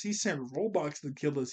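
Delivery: ordinary delivery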